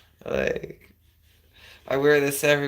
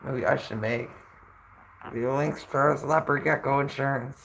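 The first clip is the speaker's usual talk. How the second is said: normal talking voice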